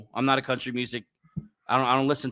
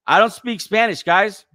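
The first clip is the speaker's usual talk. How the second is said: loud protest; 9 semitones higher, 8 dB louder than usual. Real, not acted. Blurting protest to chat.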